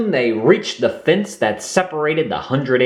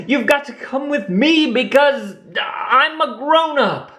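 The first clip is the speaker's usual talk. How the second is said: put-on young Roo insisting; 14 semitones higher than usual. A narrator's reading. Roo orders Lumpy.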